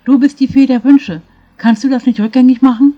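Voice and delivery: put-on old woman pleading loudly for a second chance. A narrator's reading. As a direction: beg the fairy on your knees. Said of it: "Flehend warf sie sich".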